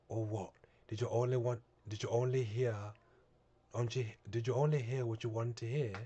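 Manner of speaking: put-on a reader trying out a Northern English accent on the line. Northern English accent, self-conscious first try. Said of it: "Northern England".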